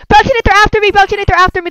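loud, high call for help. Real, not acted. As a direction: shout to a friend across the map. Calling friend for help.

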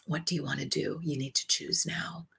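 whispered, soft and urgent; a quiet whisper pressing for a decision; hushed urgency. Retold or imitated. Archangel asking her to choose, in a whisper. Angel demands a choice.

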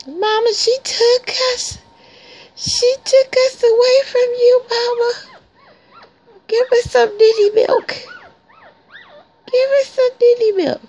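high, whiny tattletale pup voice; whiny puppy voice. Pretend. Puppies tattling to their mama, high whiny pleading voice. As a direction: whine it up high like a puppy telling on her.

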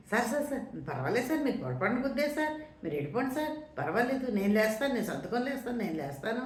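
high-pitched, deferential reassurance to a superior; placating. Retold or imitated. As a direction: act out anxious-to-please backing down. Cowed shopkeeper placates the landlord. (వినయంగా సర్దిచెబుతున్నట్టు హెచ్చు స్వరంలో)

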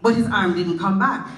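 usual speaking voice

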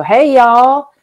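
loud, high Southern drawl greeting; Southern twang. Shown as a demonstration. Guide shows listeners her Southern roots, Southern accent. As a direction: lean hard into the Southern accent. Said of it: "drop into my true roots and go".

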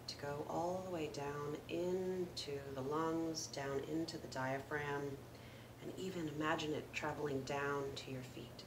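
calm meditation guide with long pauses; guided meditation. Acted. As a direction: gently lead listeners through the breath, unhurried, letting each phrase settle. Leads a breathing meditation.

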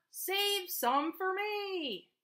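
high, slow drawn-out plea; farmer pleading. A narrator's reading. Farmer begging the horse, slow high plea. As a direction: stretch it out begging. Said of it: "cried the farmer".

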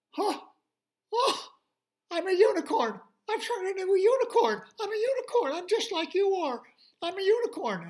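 very high, loud squealing joy; amazed delight. Acted. Pig discovering he is a unicorn, squealing high with joy. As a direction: burst out in giddy wonder.

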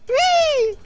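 gleeful whee. Real, not acted. Gleeful cry while fox runs.

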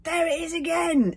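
high, loud astonished cry; astonished exclaim. Acted. Stoic, hearing the knock again, exclaims in astonishment. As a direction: blurt it out amazed. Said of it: "exclaimed Stoic in astonishment".

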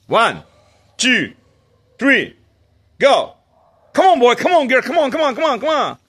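high, loud countdown and calling; excited calling. Real, not acted. Owner calling his dogs to run, high loud excited calls.

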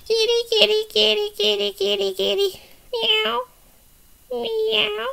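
high, even squeaky-toy voice with mews; doll calling kitty. Pretend. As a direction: call the cat as a little puppet. Doll calls the cat.

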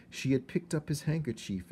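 ordinary delivery, nothing marked

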